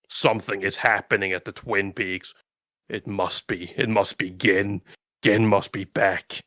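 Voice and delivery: grandpa. Acted. Grandpa senses Gin has returned, low brooding grandpa voice. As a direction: drop deep and soft like a pondering elder. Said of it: "there's fucking Grandpa's like".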